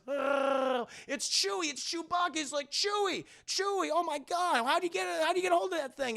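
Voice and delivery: high, fast excited yelling; excited Han. Retold or imitated. Streamer voices Han spotting Chewie, high excited shouts. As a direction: cheer your friend in, high and quick.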